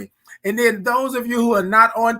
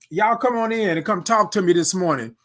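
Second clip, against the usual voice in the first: normal talking voice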